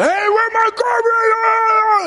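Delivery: very high, loud yelled outrage; angry shout. Retold or imitated. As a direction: yell it like the theft was just found. Brother discovers theft, shouting.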